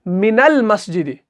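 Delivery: मिनल मस्जिद read slowly, नून running straight into लाम; joined reading. Shown as a demonstration. Teacher demonstrating मिनल मस्जिद to students. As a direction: say मिनल मस्जिद with the alif silent. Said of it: "हमने क्या पढ़ा इसको?".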